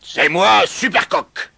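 loud, high rooster proclamation; very loud cackling. A narrator's reading. New rooster introduces himself to the hens, very loud crowing. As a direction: announce yourself at the top of your voice. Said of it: "cacta-t-il très fort".

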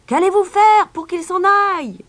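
very high, loud shrieked demand; crowd crying out. A narrator's reading. Townspeople challenge their mayor, crying out. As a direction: shout it high as an impatient crowd. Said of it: "crièrent les gens de Hamelin".

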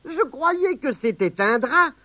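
put-on high teasing little boy; boy joking at granny; cheeky boy. Grandson teasing grandma about her hanky.

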